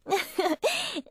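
a short, soft rising giggle; light teasing laugh under the breath. Acted. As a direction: giggle at him playfully. Teasing the boss.

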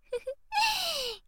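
high drawn-out giggle that falls; playful giggle. Acted. Big sister laughing before she tickles her brother, gleeful high giggle. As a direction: laugh with glee.

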